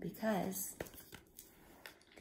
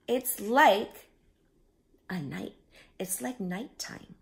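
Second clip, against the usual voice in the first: cried out; 4 semitones higher, 5 dB louder than usual. A narrator's reading. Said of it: "cried the sea urchin".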